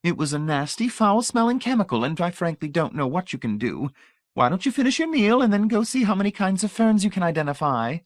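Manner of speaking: put-on high, honeyed old lady voice; sugary old lady. A narrator's reading. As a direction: pour on the sweetness like a grande dame. Voices Mrs Pritchett.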